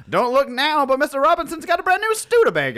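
put-on high old-timey radio gossip. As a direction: ham up a 1950s announcer. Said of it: "anytime that voice starts".

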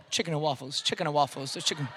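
low, soft daydreaming murmur; mock inner thought. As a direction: think it to yourself, hungry. Imagined inner thoughts, comic.

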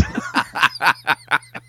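re-living the mean cackle at the actor; cackling laugh. Retold or imitated. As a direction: cackle way too hard. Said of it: "And then I cackled like a baboon".

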